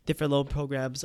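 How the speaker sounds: ordinary talk, nothing marked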